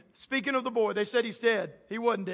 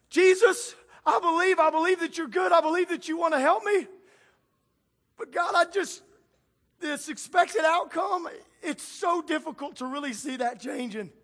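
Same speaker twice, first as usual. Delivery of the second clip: higher, earnest pleading prayer; voiced believer's prayer; 4 semitones higher than usual. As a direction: plead with Jesus through your doubt.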